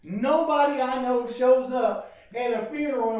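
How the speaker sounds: loud, ringing pulpit cry; fiery preaching. Acted. Pastor to his church, preaching at full force.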